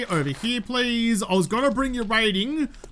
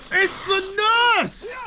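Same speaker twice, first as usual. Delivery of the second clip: high-pitched excited cry; sudden delighted squeal of recognition; excited announcement; 11 semitones higher, slower than usual. Real, not acted. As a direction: call out a thrilling sighting, voice leaping way up. Spots the nurse in game.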